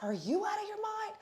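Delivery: very high, squealed retort; mock-outraged high squeal. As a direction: throw the question back in a high, incredulous pitch. Acted quarrel, her retort to him.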